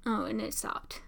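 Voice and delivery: hushed whisper. Real, not acted. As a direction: breathe it without voice. Tense moment on screen.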